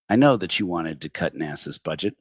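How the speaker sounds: ordinary talk, nothing marked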